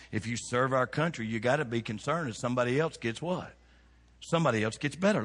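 normal talking voice

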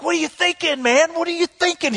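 high-pitched exasperated demand; indignant confrontation. Retold or imitated. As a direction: get up in his face, incredulous. Confronts a man in his face.

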